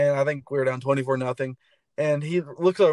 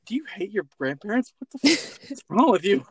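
higher, mock-indignant scolding; mock outraged rant; 8 semitones higher than usual. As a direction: pretend to be appalled at a traitor fan. Acted outrage at a Florida fan.

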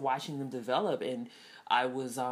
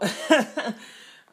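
a rising burst of laughter, high; 9 semitones higher, 9 dB louder than usual. Real, not acted. Laughing after her own "Nope", high burst.

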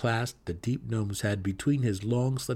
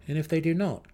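dark elf's question; 7 semitones higher than usual. Acted. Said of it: "Drizzt asked".

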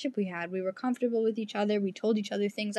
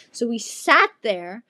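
normal talking voice

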